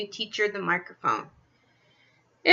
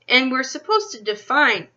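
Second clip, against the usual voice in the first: ordinary delivery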